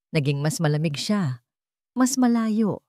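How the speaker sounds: ordinary delivery, nothing marked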